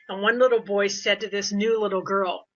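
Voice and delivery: normal talking voice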